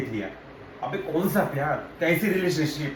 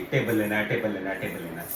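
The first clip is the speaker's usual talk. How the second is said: low, repetitive mock nagging chant; mock girlfriend's relentless low-pitched pestering; mimicked nagging; 7 semitones lower, 5 dB quieter than usual. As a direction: drone it over and over, imitating a nag. Same nagging mimicked a second time.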